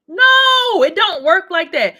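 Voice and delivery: loud, high, drawn-out "no" into firm rebuttal; emphatic shouted no. Real, not acted. Correcting listeners on taking Ferrari parts, loud emphatic shout. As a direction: holler the objection, stretching the first word.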